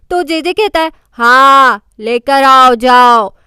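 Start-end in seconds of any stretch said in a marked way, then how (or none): 1.1-3.3 sleepy command. A narrator's reading. Sleepwalker orders Mujo.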